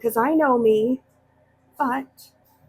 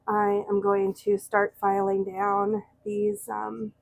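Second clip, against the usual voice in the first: talking through a face mask; muffled behind a dust mask. Real, not acted. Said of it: "so if I sound a little muffled".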